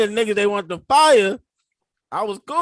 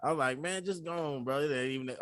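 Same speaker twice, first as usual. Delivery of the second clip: much softer, low and soothing; hushed "chill, bro" to a coworker about to swing; soft calming; 5 semitones lower, 12 dB quieter than usual. Retold or imitated. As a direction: talk him down gently.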